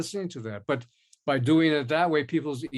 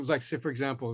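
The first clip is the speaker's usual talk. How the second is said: ordinary talk, nothing marked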